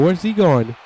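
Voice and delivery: ordinary delivery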